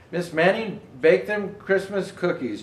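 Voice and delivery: ordinary delivery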